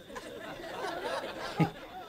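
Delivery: high, breathy amused laugh; a short scornful laugh at the king; mocking laugh. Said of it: "Vasishtha laughs at him".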